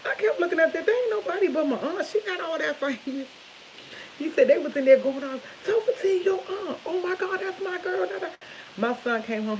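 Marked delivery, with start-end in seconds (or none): 0.1-8.3 excited retelling. Retold or imitated. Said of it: "He was like".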